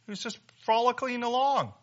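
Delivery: high-pitched, forceful sermon delivery; fired-up preacher driving each phrase home; impassioned preaching. Real, not acted. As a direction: preach it with rising urgency, pitched well above normal talk. Preacher in heated full flow.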